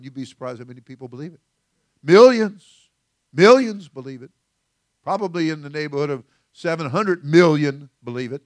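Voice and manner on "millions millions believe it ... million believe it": preaching emphasis. Real, not acted. Preacher rising against false doctrine.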